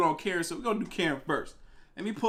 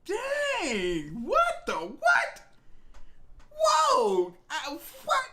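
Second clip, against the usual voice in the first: very high, stretched cries of disbelief; shocked disbelief; 13 semitones higher, much slower than usual. Real, not acted. Streamer reacting to the nine-year-old's demand, shocked high cries.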